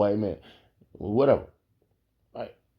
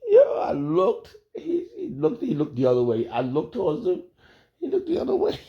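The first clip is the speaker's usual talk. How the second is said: laughing retelling; 10 semitones higher than usual. Real, not acted. Retelling awkward glance exchange.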